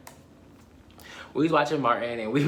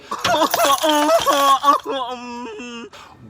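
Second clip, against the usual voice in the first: high, loud cackling laugh; loud wheezing laugh; 8 dB louder than usual. Real, not acted. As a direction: burst into a loud screeching laugh. Big laugh at own story.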